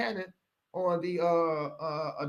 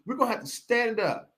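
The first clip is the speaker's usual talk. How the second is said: ordinary delivery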